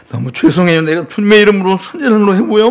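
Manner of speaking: a touch of teary apology; lightly voiced crying sinner; mock tearful. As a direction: confess it weeping, sorry. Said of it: "그냥 눈물을 질짜면서".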